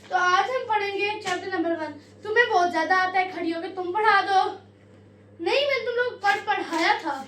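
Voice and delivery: a girl mimicking her Urdu teacher, scolding the class; strict teacher. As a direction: lecture the lazy class sternly. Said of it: "strict teacher part one".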